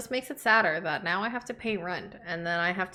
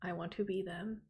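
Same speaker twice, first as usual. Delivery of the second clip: retold quiet awe; 8 dB quieter than usual. Recalling watching a honeymoon video over dinner, quiet awe. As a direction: say it softly, awestruck, recalling the moment. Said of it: "being like".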